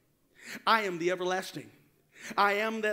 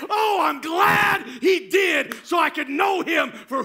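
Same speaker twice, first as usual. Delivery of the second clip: raised, driving sermon voice building to shouts; full-force preaching; 4 semitones higher, 8 dB louder than usual. Real, not acted. Preacher to congregation celebrating the resurrection, full-force preaching. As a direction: proclaim it with mounting fervor.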